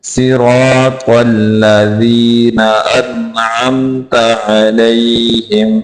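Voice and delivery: slow, drawn-out tajweed recitation; measured model recital for learners; Quranic recitation. Shown as a demonstration. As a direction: recite the verse slowly, holding the long vowels.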